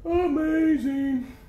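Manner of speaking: a high, stretched-out, slow amazing; drawn-out amazement. Acted. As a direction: stretch the word out like a showman. Host asks his own rhetorical question.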